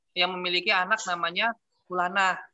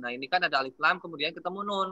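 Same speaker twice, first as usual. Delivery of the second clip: ordinary talk, nothing marked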